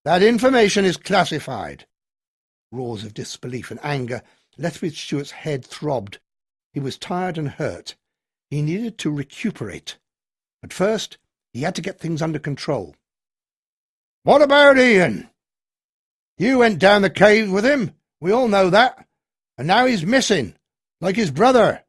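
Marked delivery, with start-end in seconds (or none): 0.0-1.9 firm refusal. A narrator's reading. Colonel stonewalls the crowd. 14.2-21.8 angry accusation. A narrator's reading. Red-faced local accuses the colonel, loud and angry.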